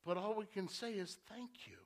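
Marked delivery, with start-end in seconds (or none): 1.3-1.8 breathy whispered thank you. Acted. Believer answering God's love, whispered. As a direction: barely whisper it in gratitude.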